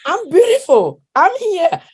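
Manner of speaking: high, bold and proud, owning it; proud defiance. Real, not acted. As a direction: declare it like a proud challenge. Proud defiant self-declaration, laughter.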